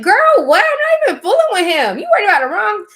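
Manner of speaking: high, loud incredulous retort; brushing off a girl who wants to fight; retorting to the rival girl. Retold or imitated. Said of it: "I'm like".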